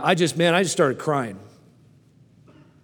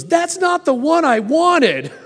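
put-on much higher, louder, slow whiny child voice; disappointed little girl; 12 semitones higher, 5 dB louder, slower than usual. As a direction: mimic a ten-year-old crying over the wrong bike. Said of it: "And she goes".

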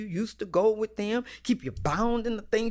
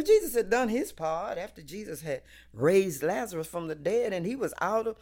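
normal talking voice

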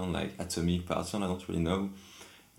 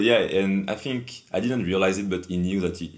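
usual speaking voice

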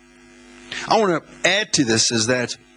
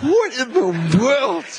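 bewildered; 8 semitones higher, much slower than usual. Retold or imitated. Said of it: "I'm like".

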